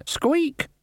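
tiny high-pitched squeak; high rat squeak. A narrator's reading.

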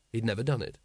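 usual speaking voice

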